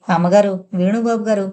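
usual speaking voice

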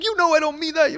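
high, loud imitation of a quarrelling lover; mock pleading. Lover takes back words mid-quarrel, pleading loudly. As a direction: whine it in hammed-up protest.

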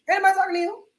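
ordinary talk

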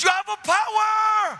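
high, loud, slow wail; astonished shout. Retold or imitated. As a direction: stretch it into an amazed yell. Healed man shouts again.

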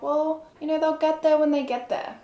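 high-pitched imitation of a dismissive dispatcher; unconcerned dispatcher. Dispatcher answering how long help will be, mock-dismissive. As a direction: mimic a dispatcher brushing the caller off, pitched well up. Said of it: "not taking this call seriously".

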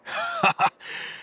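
a quick chuckle at her bluntness; short amused laugh. Real, not acted.

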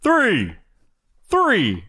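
high sing-song chant of three. Pretend. Mimicking three.

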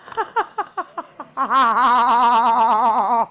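hearty laugh. Real, not acted. Gamer laughing at a friend's joke, hearty laugh.

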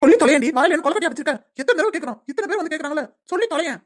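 fast, high, loud-starting scolding; exasperated demand. Acted. Elder pressing weeping Tara to speak, exasperated demand. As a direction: snap impatiently for an answer.

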